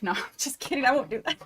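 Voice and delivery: usual speaking voice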